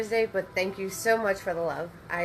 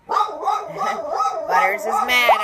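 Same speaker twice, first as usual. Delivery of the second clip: laughing it out, higher and louder, slowly; 8 semitones higher, 7 dB louder, much slower than usual. Real, not acted. Host laughing at her mad dog Butters, laughing. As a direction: crack up while saying it.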